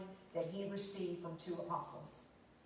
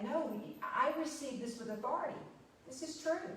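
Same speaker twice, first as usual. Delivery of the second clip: insist, raised and firm; he won't be doubted on this; emphatic demanding tone; 6 semitones higher than usual. Retold or imitated. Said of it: "is he just that emphatic".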